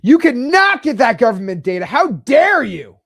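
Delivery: loud, high, indignant clerk refusing; mock outraged bureaucrat; snooty clerk. Clerk scolding a citizen asking for data, loud and high. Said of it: "they're like".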